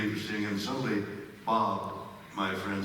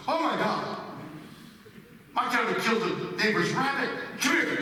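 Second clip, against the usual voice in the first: panicked friend; 11 semitones higher than usual. Retold or imitated. Friend Bob seeing the dead rabbit, high panicked outburst. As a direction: blurt it out in alarm. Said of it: "my friend Bob goes".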